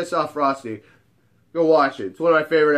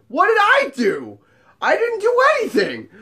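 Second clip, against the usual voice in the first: high-pitched, whiny-pleading denial; mock innocent protest; 4 dB louder than usual. Man to angry woman, exaggerated protest of innocence. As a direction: protest your innocence, hammed-up.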